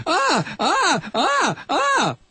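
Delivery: mock cackle. Mocking the quoted blogger.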